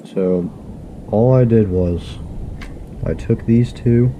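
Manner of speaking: ordinary talk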